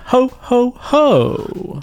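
high jolly Santa laugh. Acted.

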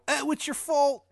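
high, loud, bad Maryland accent; hammed-up Maryland accent, cried out high; Shithead Steve, terrible Maryland accent. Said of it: "In his terrible accent".

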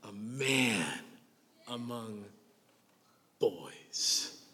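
each word set apart with long pauses, slow and soft; slow emphatic repeat. Shown as a demonstration. Preacher savoring a good line for the ladies, slow and soft. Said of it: "that is a good line, ladies".

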